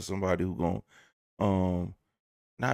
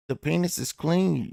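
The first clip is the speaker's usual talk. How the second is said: lightly put-on higher servant voice; mock servant; 7 semitones higher than usual. Servant addressing royalty in exaggerated submission, mock servile. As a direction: report it like a dutiful attendant.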